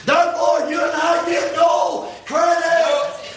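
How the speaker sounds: high, loud, slow preacher's shout, every word hammered; preaching at full force. Acted. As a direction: drive it home to the congregation.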